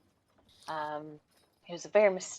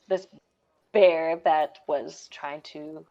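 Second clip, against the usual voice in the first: usual speaking voice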